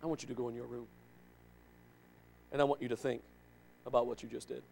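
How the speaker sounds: a calm, dead-serious father sending his son to his room; quiet, heavy fatherly sternness; stern father. Retold or imitated. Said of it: "he'd say".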